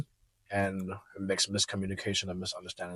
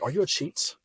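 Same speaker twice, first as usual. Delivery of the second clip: mock accusing; 7 semitones higher than usual. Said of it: "she was like".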